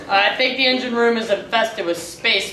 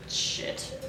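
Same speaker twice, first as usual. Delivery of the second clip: breathy whispered shit; whispered curse; 14 dB quieter than usual. Real, not acted. Improviser cursing to herself, whispered. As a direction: hiss the curse under the breath.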